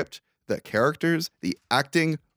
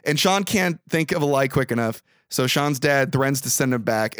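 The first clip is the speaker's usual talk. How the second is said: usual speaking voice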